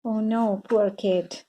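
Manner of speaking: ordinary delivery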